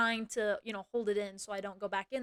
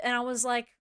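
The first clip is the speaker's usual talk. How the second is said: ordinary delivery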